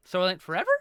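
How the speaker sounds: "Soylent Forever?" with a rising question tune. Shown as a demonstration. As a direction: ask the name as if unsure. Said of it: "said your name like a, uh, a question".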